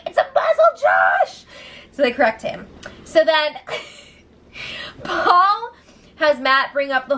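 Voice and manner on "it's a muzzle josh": high put-on voice shouting the name; mock housemates' shout. Housemates correcting Josh, mock high shout. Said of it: "They're all like".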